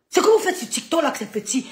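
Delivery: rising to a loud taunt. Real, not acted. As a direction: hammer the put-down home. Repeats taunt softly then loud.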